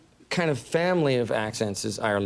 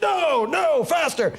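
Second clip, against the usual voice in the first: high, urgent mock yell; drill-instructor shout; 13 semitones higher, slower than usual. Trainer in a combat film training scene, high shout. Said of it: "training scenes in a combat film".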